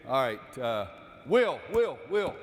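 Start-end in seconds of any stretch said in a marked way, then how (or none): 1.3-2.3 calling out names. Real, not acted. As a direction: call him out to start the play. Coach calling name in drill.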